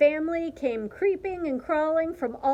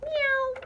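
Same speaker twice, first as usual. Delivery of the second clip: high imitated mew; one small high meow; cat meow; 14 semitones higher, 3 dB louder than usual. A narrator's reading. Narrator voicing a cat suitor, high meow.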